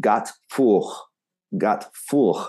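put-on gate four as "gat foor" in French accent; thick French accent. French friend asking for his gate, thick French accent. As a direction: imitate a thick French accent, each word apart. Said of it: "he said it really with his thick, you know, French".

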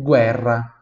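guerra as a firm "GWEH-rra"; Italian pronunciation. Shown as a demonstration. Teacher repeats for students, guerra in Italian with "gw". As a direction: say guerra with audible U and trilled R. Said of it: "Em italiano".

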